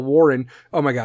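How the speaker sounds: ordinary delivery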